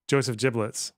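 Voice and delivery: ordinary delivery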